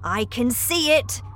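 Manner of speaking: lively storybook cry; excited cry. A narrator's reading. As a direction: call it out, pleased to spot it. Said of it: "cried Mister Wonka".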